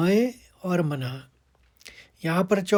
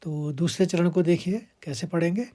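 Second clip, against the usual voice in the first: ordinary talk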